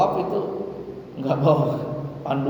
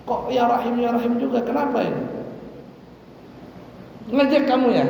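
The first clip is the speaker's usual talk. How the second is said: higher, irritated Bedouin demanding why he is copied; annoyed Bedouin; 7 semitones higher than usual. Retold or imitated. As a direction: snap at the stranger who mimics you. Bedouin confronts the copying Prophet.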